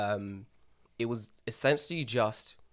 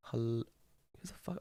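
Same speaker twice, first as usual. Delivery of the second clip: muttered confusion; 10 dB quieter, much slower than usual. Retold or imitated. Stranger greets him by name.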